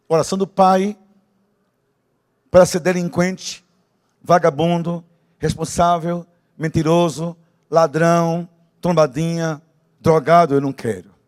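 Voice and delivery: loud, high fervent prayer cry; preaching at full force. Acted. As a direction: cry out the list to God.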